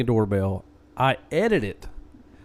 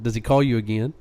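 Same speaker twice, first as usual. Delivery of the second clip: ordinary delivery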